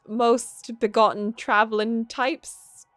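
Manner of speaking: a lightly done, slow Scottish lilt, voicing the magical girl; a self-admittedly bad Scottish accent, drawn out; attempted Scottish accent. Reading the magical girl's greeting to the shopkeeper in a lightly done Scottish accent. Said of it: "I can't do Scottish".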